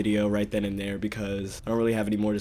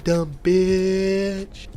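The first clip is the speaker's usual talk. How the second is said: long exaggerated bitch; 8 semitones higher, 4 dB louder than usual. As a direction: drag out bitch and let it sink. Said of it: "like, the long exaggeration".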